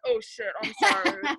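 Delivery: high apology through laughter; laughing apology. Real, not acted. Laughing at the wrong moment, apologizing through giggles. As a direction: apologize while still giggling.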